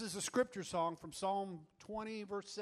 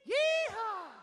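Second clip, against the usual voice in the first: yeehaw shout; 12 semitones higher than usual. Shown as a demonstration. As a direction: whoop it like riding a horse. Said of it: "And we all go".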